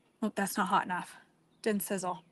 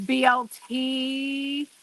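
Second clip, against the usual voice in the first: slow, stretched "B L T", lingering on each letter; drawn-out B L T; much slower than usual. Shown as a demonstration. Cook tells viewers how to say B L T, slow and stretched. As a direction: savor every letter of "B L T". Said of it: "You have to say it like that".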